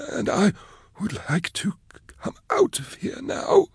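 begging timidly to be freed; stammering plea. Acted. Prisoner begging rescuers to let him out, soft high stammer.

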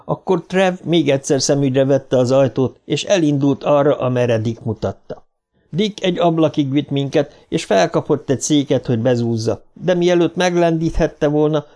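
plain reading voice

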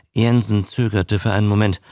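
ordinary delivery, nothing marked

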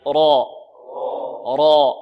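loud, full ra with a heavy r. Shown as a demonstration. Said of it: "انطقوا معي".

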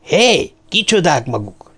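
high "hey" called out; agitated challenge. A narrator's reading. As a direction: call out in agitation. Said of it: "kérdezte izgalmában olyan angol kiejtéssel".

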